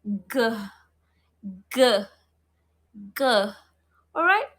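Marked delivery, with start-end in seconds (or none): 0.0-0.5 G said as a hard "guh"; bare hard G as in "go"; hard G sound. Shown as a demonstration. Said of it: "We pronounce it as". 1.7-3.2 G repeated as an isolated hard "guh"; hard G sound. Shown as a demonstration. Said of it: "We pronounce it as".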